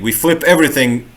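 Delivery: ordinary delivery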